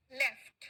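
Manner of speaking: लेफ्ट with short e and crisp ft; past-tense left. Shown as a demonstration. Teacher repeating for the class, लेफ्ट with clear ft. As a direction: finish लेफ्ट on a released t. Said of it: "मेरे साथ में इसका pronounce".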